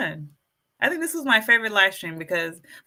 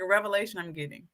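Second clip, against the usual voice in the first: normal talking voice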